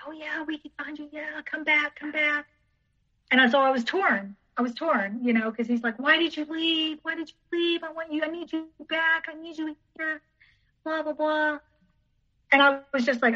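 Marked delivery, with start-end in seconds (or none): 0.1-2.5 soft, higher-pitched coaxing; lightly voiced old principal welcoming someone back; imitated eager welcome. Old principal asking her to come back, soft imitated coaxing. 6.0-11.6 high, soft, monotone mock pleading; imitated whiny pleading. Ex asking why she left, mock whiny pleading. Imitates ex whining for her return.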